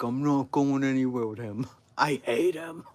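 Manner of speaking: loud, high mock-tantrum protest; retold tantrum yell. Daughter refusing Disney trip with dad, yelling. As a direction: refuse at full volume, hammed up.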